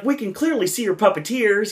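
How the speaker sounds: a hint of a character voice; lightly acted heckle. Retold heckle to puppet character.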